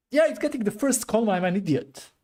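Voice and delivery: high, loud burst of realization; excited self-scolding. Real, not acted. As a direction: exclaim as the mistake clicks. Realizes a coding mistake.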